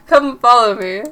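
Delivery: loud, lightly put-on British voice; British accent. Reginald inviting companion to follow, loud attempted British accent. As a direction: call it out with a British lilt. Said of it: "I don't think he's British".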